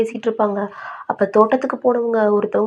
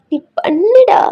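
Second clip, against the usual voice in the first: high, louder urging that swoops up; coaxing insistence; 11 semitones higher, 6 dB louder, slower than usual. Acted. Grandmother urging grandson to promise her, coaxing insistence. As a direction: press him to promise, pitch soaring.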